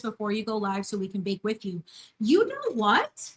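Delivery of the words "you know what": excited. Real, not acted. Viewer suggests listing ingredients.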